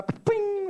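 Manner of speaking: high, loud ringing ping; ping like a light bulb coming on; comic high-pitched sound effect; imitated ping.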